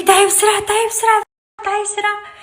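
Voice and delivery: high, pushy mock call to hurry up; squeaky imitation of him rushing her; imitating her impatient husband. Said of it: "عم يقول لي". (تقليد صوت زوجها المستعجل بنبرة عالية)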